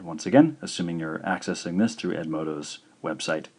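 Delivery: ordinary delivery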